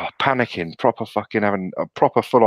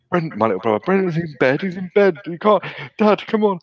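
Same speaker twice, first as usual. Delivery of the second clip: put-on much higher, urgent pleading kid; frantic pleading; 9 semitones higher than usual. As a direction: panic to stop dad, pitch climbing.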